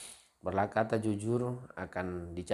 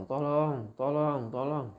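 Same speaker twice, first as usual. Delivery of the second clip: high, louder mock cries for help; berteriak minta tolong; 8 semitones higher, 4 dB louder, slower than usual. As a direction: call out as the farmer faking danger. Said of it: "dia berteriak". (teriakan minta tolong pura-pura, bernada tinggi)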